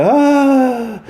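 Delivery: long high 'ah'; sustained sigh of restored breathing; imitated relieved breath. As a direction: mimic air flowing back in. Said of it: "you get the tube back in".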